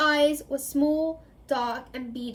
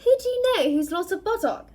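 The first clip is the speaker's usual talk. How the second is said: higher, quick and keenly curious; interested; 7 semitones higher, 4 dB louder, faster than usual. Acted. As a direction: ask it with eager curiosity. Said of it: "asked Mad-Eye interestedly".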